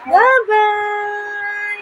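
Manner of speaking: put-on "Bye-bye" sung out high and drawn out for the baby to copy; sing-song bye-bye for baby. Shown as a demonstration. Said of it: "Imitate what Mommy's doing".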